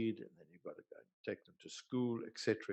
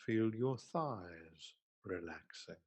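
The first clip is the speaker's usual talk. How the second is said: guided meditation; 2 semitones lower than usual. Acted. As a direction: ease them gently down, leaving space between phrases. Leads a guided relaxation.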